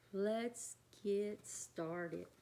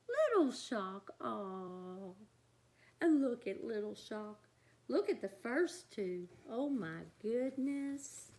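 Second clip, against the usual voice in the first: ordinary delivery, nothing marked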